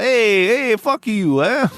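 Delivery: lightly voiced Italian tough guy snapping back. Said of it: "They're like".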